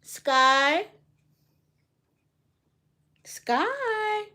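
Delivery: high call out to the cat Sky; sing-song pet call, high and stretched. Real, not acted. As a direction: call the cat from across the room. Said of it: "Trying to call the cat over".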